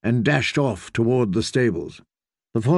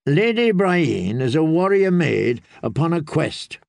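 reassuring character voice, pitched up a little; kindly septon; 4 semitones higher than usual. Acted. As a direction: put the lad at ease with a memory. Septon answers for Brienne.

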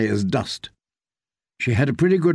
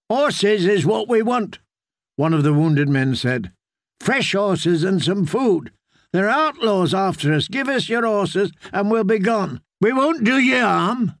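higher, weary outlaw voice; gruff bargaining; 6 semitones higher than usual. A narrator's reading. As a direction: try to strike a deal.

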